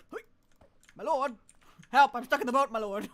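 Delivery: stuck and calling frantically; panicked plea to the lord; real panic. Real, not acted. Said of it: "The panic. The actual panic".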